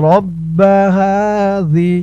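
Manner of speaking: হাযি with হা held one alif long, slow; one-alif stretch. Shown as a demonstration. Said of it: "টান হবে এক আলিফ।". (হাযি-র হা এক আলিফ টেনে)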